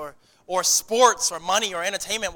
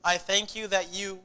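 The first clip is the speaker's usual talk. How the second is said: closing prayer. Acted.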